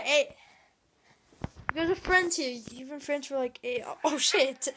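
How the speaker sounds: normal talking voice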